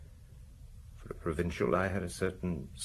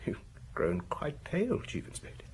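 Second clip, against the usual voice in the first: menacing whisper; 7 semitones higher, 8 dB quieter than usual. Acted. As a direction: whisper it to unsettle him. Taunting the pale inspector.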